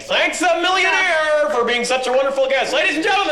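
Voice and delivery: loud, rising announcer voice; showman sign-off. Acted. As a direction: wrap the show up like a big showman. Host closing show, announcing.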